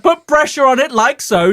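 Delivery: high, loud British TV cook; Julia Child parody. Acted. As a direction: instruct emphatically in a hammed-up high voice. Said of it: "pretending basically to be Julia Childs".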